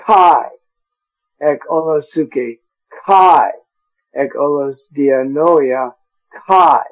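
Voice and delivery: reading the Greek, every "kai" landing hard; stress on kai. Shown as a demonstration. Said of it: "So we have kai, kai, kai".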